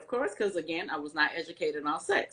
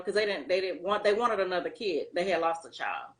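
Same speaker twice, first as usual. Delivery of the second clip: ordinary talk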